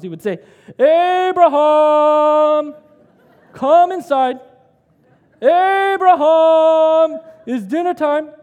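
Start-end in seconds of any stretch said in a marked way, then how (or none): 0.8-2.7 long drawn-out shout, high and loud; dad yelling name. Retold or imitated. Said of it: "he would yell out of the top of his lungs". 3.5-8.3 high, loud calling from the front door; dad yelling. Retold or imitated. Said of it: "he would yell out of the top of his lungs".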